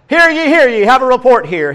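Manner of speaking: loud, high crier's proclamation; town crier call. Pretend. Preacher playing a town crier, loud and high.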